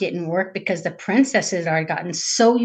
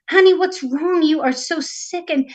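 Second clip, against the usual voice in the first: higher-pitched, caring sister voice; concerned little sister; 6 semitones higher than usual. Acted. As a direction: ask with worry, pitched up. Little sister worries about princess.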